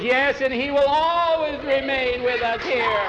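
loud, wailing proclamation of faith; congregation's response. A narrator's reading. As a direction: intone it high and fervently.

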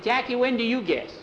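very high questioning voice; high-pitched, put-on character; Juby character voice. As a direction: ask in a squeaky little voice.

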